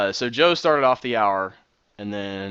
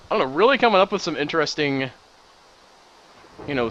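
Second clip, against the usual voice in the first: ordinary talk, nothing marked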